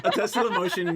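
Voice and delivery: giggling through the words; laughing. Real, not acted.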